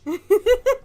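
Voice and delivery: high, loud giggle. Real, not acted. Streamer tickled by own challenge, giggling. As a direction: giggle at your own outrage.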